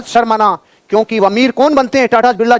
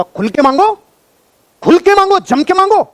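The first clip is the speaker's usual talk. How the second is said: rallying cry; 11 semitones higher than usual. Real, not acted. Coach urging salesmen to ask openly, rallying shout. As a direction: fire up the room.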